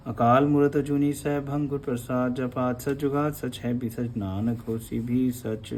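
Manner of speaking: slow, soft devotional recitation; prayer recitation. Acted. Teacher reciting Mool Mantar for the sangat, devotional chant. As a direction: recite it reverently like scripture.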